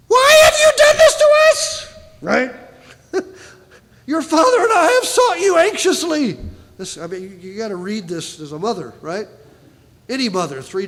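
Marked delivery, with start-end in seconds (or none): 0.1-1.9 high shrill scolding shout; frantic mother. Pretend. Mary scolding her son after three days, loud high shout. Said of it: "his mother said to him". 4.1-6.4 high loud distressed pleading; anxious mother. Pretend. Mary to her lost son, high anxious plea. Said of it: "his mother said to him".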